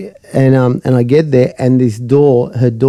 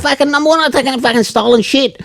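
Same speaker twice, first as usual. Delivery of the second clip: high-pitched indignant refusal; mock angry refusal; 11 semitones higher than usual. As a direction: imitate someone refusing in an outraged, raised pitch. Acted refusal in the quarrel.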